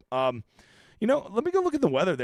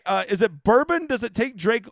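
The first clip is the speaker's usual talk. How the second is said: normal talking voice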